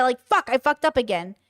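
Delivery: normal talking voice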